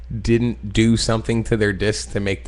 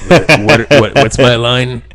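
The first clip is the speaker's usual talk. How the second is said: mock panicked shout; 2 semitones higher, 8 dB louder than usual. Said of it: "You're like".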